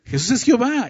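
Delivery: high-pitched, emphatic proclamation; daring, exclaimed statement of faith; bold declaration. Real, not acted. Bold declaration after daring listeners.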